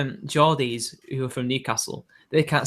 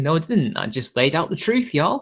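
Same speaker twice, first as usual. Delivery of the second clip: normal talking voice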